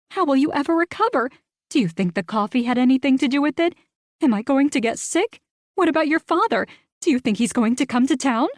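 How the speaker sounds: high, fast, piling-on questions; breathless gossip rant. Acted. Rita ranting down the phone, high and fast. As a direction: fire questions without a breath. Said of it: "launching into a rant".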